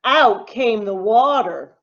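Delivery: ordinary delivery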